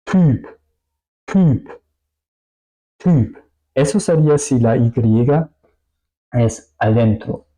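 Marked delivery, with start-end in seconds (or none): 0.0-3.4 "Typ" with a rounded ü, each one separate, high and falling; Y como U alemana. Shown as a demonstration. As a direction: say "Typ" as isolated "tüüp". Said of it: "suena un poco más así". ("Typ" con vocal ü, redondeada)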